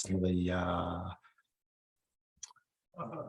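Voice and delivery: normal talking voice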